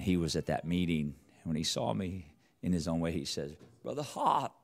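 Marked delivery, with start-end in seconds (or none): 3.8-4.5 put-on Kazakh accent. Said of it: "He has this, this accent".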